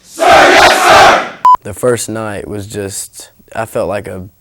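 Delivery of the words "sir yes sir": barked sir yes sir. Real, not acted. Recruits answering in unison.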